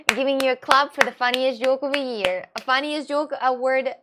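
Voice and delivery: loud ceremonial announcement; mock award announcer. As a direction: proclaim a prize to the room.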